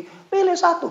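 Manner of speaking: high, loud salesman pitch; mock pushy recruiter. Preacher mocking a pushy recruiter, high and loud. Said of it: "Nggak seperti".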